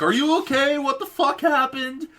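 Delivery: high worried friends; squeaky mock-worried classmates; frantic friends asking what happened, high pitched; texting friends. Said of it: "My phone is blowing up with people, like".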